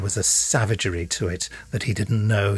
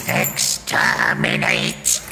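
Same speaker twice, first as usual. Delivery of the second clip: drawn-out, high, grating shriek; slow, insane battle cry; shrieking Dalek; 9 semitones higher, much slower than usual. A narrator's reading. Dalek shrieking its battle cry at the Doctor. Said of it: "the Dalek shrieked".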